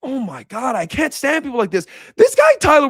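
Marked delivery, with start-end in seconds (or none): 2.2-2.9 high, loud burst of annoyance; real high-pitched indignation; exasperated outburst. Real, not acted. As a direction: leap up high and loud, fed up. Singling out the fan he resents.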